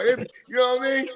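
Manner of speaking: ordinary talk, nothing marked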